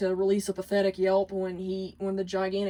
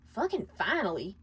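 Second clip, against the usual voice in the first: spat; 4 dB quieter than usual. Acted. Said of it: "Millie spat".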